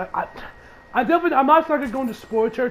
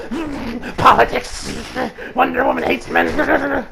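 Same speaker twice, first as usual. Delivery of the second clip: triggered critic; 3 semitones higher, slower than usual. Retold or imitated. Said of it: "and how triggered he is".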